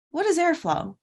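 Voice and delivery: ordinary talk, nothing marked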